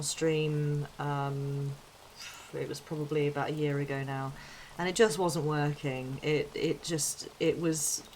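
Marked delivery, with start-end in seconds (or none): none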